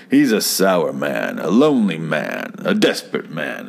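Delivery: mock-serious storyteller intoning each phrase; a hint of a solemn narrator. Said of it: "Rod goes on to describe him".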